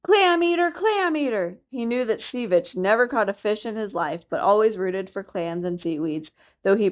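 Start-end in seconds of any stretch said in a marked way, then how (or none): 0.0-1.6 high shouted taunt, fading on the repeat; shouting. A narrator's reading. Young seal shouts insults at the walrus. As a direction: yell the insult as loud as you can. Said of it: "shouted as loud as he could".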